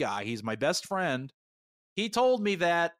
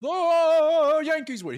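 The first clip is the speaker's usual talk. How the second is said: mock scream; 12 semitones higher, 7 dB louder, much slower than usual. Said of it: "He starts screaming that".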